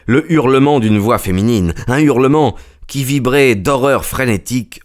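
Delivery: usual speaking voice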